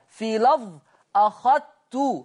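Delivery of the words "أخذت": "أخذت" بإدغام الذال في التاء ببطء; تُنطق "أخذت" بتاء مشددة بلا ذال; أخذت بالإدغام. Shown as a demonstration. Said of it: "مفيش بقى ذال". (تلاوة بطيئة لـ"أخذت" مدغمة)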